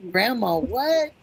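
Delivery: high, slow confused question; puzzled. Retold or imitated. Puzzled child asking grandma.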